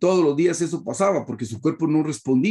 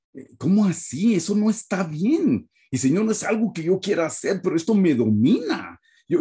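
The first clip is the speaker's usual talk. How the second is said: desperate prayer; 5 semitones higher than usual. Retold or imitated. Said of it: "me fui a orar desesperado".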